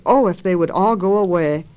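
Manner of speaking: ordinary delivery, nothing marked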